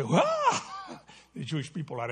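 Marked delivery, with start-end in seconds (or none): 0.0-0.2 joyful whoop. Retold or imitated. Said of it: "and the Gentiles say".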